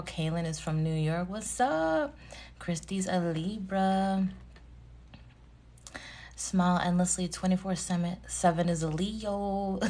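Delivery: usual speaking voice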